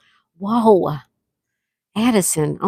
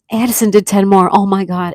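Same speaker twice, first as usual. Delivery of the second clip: be floored by the gift; gasping surprise; 8 dB louder than usual. Real, not acted. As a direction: gasp then loud, thrilled exclamation. More gifted memberships arrive.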